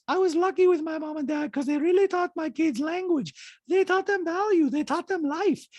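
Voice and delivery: put-on very high-pitched accented caricature; squeaky falsetto mimicry of a reality star; Diana impression. Host mimics reality star in recap, high-pitched caricature. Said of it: "Diana in a talking head says".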